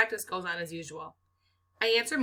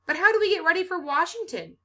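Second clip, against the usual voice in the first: eager question; 10 semitones higher, 5 dB louder than usual. A narrator's reading.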